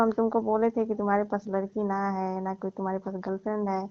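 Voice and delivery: ordinary talk, nothing marked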